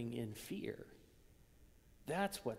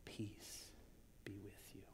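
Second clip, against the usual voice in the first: much softer, lower, whispered; 5 semitones lower, 14 dB quieter than usual. Retold or imitated. Jesus greeting frightened disciples, whispered. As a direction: breathe "Peace be with you" as a hush. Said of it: "what he says is".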